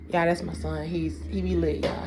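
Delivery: ordinary talk, nothing marked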